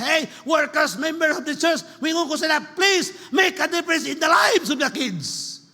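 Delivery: high, forceful preacher's appeal; loud exhortation. Acted. Preacher appeals to workers for the kids, loud exhortation. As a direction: call out urgently to the whole church. Calls on church workers to help.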